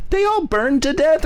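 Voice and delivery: very high aghast question; mock horrified mom. Mom realizing the toys died, high mock horror. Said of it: "She's like".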